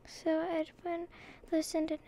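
usual speaking voice

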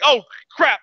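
high startled "oh crap"; startled yelp. Acted. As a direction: caught off guard, yelp it. Octopus man startled on toilet.

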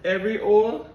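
high, loud patois with "hoe" as "oh"; high Jamaican accent, H dropped; patois rerun. Shown as a demonstration. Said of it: "Run that back".

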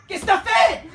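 put-on loud, high yell at a child; furious shout. Mother yelling at her crying child. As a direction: scream at the kid who did something.

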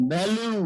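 ভ্যালু opening on a voiced V; ভ্যালু as a careful example word; demonstrating V. Shown as a demonstration. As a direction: model ভ্যালু with vocal cords buzzing on V. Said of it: "ভিতে ভ যদি উচ্চারণ করি".

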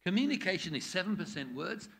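ordinary delivery

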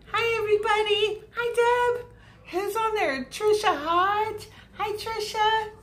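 high, loud, delighted hello; excited greeting. Real, not acted. Greeting live viewers as they join.

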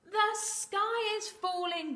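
high, slow wail of alarm; gobbling turkey. Acted. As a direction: cry it out as a flustered turkey. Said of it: "gobbled Turkey Lurkey".

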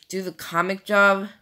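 ordinary talk, nothing marked

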